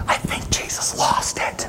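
slow, whispered gossip; a sidelong whisper about Jesus; whispered aside. Acted. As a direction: mutter it behind his back. Peter's imagined aside.

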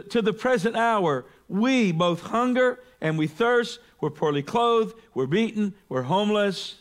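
high, pointed ironic contrasts; sting them with the contrasts; biting sarcasm, pitched high; sarcastic. Acted. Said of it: "So sarcastic, by the way".

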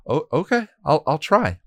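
high, hesitant stammering promise; unsure and a little scared; timid stuttering reply; nervous stammer. Acted.

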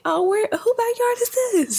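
high-pitched imitation of a baffled mom; imitating mom. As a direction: voice mom's puzzled question up high. Mom voiced in retold surprise.

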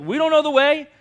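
high, loud desperate plea; Thomas pleading. Retold or imitated. Preacher voicing Thomas begging Jesus for the way, high and loud. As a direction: beg for help, pitched high and loud. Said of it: "Thomas is like".

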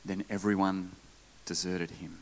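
low, hushed Scripture reading; quiet, desolate verse; somber reading. A narrator's reading.

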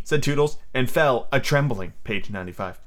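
usual speaking voice

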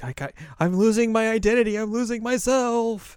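high, loud wail slowing at the end; hammed-up identity crisis; mock panic. Azusa fears becoming like the upperclassmen, mock panic.

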